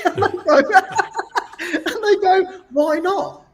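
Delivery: high, giggling mock reply; laughing retelling. Host retelling callers' question to David, high and laughing. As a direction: laugh through the quote.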